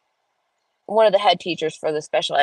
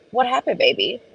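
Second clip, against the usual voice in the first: tender mom question; 6 semitones higher than usual. Retold or imitated. Mom to her crying son, tender and coaxing. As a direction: gently ask your upset little boy.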